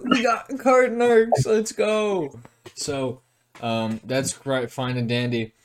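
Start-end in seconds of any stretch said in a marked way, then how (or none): 0.1-2.4 high, excited squeal of disbelief; retold freak-out, pitched up high. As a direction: yelp it like you can't believe your luck. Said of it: "I'm freaking the fuck out".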